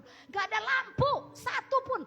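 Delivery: high, loud, stretched-out complaint; exasperated exclamation. Real, not acted. Preacher complaining the site has no lamps, exasperated high shout. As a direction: cry out in exasperation. Exclaiming over the total darkness.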